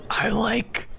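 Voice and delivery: whispered character impression, long pause before the last word; Starlight Glimmer. Streamer quotes a cartoon character who likes kites, whispering. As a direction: imitate her in a slow, voiceless whisper. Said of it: "Starlight Glimmer, where she's like".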